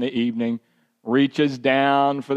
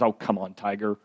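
coaxing the cat; 7 semitones lower, 6 dB quieter than usual. Retold or imitated. Dad coaxes the hissing stray cat, lower and soft. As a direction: lower and softer, gently coaxing.

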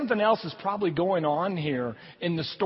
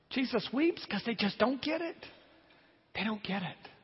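much softer, sinking sad close; soft and sorrowful; 3 semitones higher, 8 dB quieter than usual. Acted. Pastor grieving that people don't get it, hushed. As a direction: let it fall away quietly in sorrow.

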